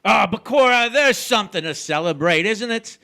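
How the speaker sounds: boisterous gloating. Acted. Husband gloats about selling.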